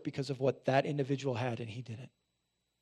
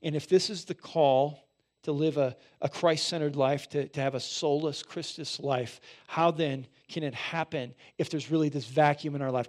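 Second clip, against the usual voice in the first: ordinary talk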